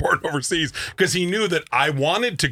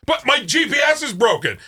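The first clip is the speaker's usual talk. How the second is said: whiny excuse; 9 semitones higher than usual. Retold or imitated. Imitating the lost driver.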